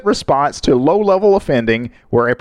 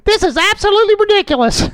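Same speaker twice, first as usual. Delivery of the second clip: high indignant voice. Retold or imitated. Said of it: "that was you in that recording".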